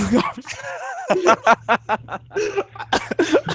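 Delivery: high-pitched fit of laughter; helpless giggling. Real, not acted. Derailing the podcast over a friend on screen, helpless giggling. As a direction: crack up, high and drawn out. Cracks up while derailing the show.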